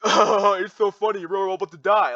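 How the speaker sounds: high, loud fake-laughing mockery. Imitating people who laugh at the problem, mock hilarity. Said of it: "what you're laughing at".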